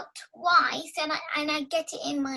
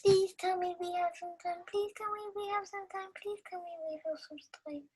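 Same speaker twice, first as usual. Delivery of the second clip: begging softer each time; repeated plea sinking to a murmur; pleading, fading; 2 semitones higher, 9 dB quieter, faster than usual. Real, not acted. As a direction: plead until it fades out.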